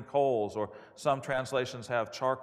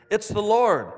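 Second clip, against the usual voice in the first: high, loud announcement; raised voice, falling firmly on the last word; exclaimed recognition; 6 semitones higher, 7 dB louder than usual. A narrator's reading. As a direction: cry it out to a friend in the boat. Disciple's cry of recognition read aloud.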